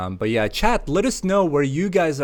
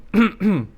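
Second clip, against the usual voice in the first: throat clearing; 8 semitones higher, 4 dB louder than usual. Real, not acted. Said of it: "stitchy throat".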